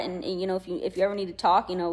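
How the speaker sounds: ordinary delivery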